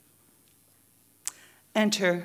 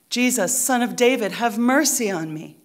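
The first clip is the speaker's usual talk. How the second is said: loud, insistent plea; yelling; 4 semitones higher, 7 dB louder than usual. Acted. Preacher repeats the blind beggar's cry to Jesus, yelling. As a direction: call out loudly as the insistent beggar. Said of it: "He yells".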